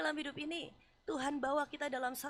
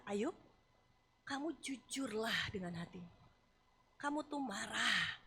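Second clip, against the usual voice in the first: softer, slow, gentle words; tender confrontation; 4 semitones lower, 4 dB quieter, slower than usual. Acted. God quietly urging Job to admit his anger, soft and tender. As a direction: coax Job toward honesty.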